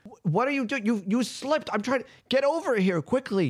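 high, flustered shouted excuse; Jeremy yelling. Acted. Jeremy yelling back that she slipped, high and flustered. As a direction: call back, defensive and rushed. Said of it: "he's yelling that out loud".

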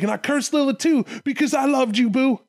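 high-pitched wounded outburst; hammed-up lovesick hurt turning bitter; heartbroken God. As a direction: wail the betrayal in mock anguish. Acted God's heartbroken outburst.

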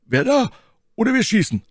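high, loud threat to fire; armed warning. A narrator's reading. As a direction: warn them you will shoot. Athos threatens to shoot.

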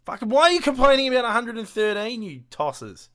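loud, high outburst at the critics; angry rant. Acted. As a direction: shout it like a furious fan. Mock rant at complaining fans.

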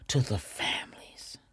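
breathy whisper of condolence to the families. Real, not acted. Telling listeners of her sympathy, in a soft whisper. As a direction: let the words fall to a hush.

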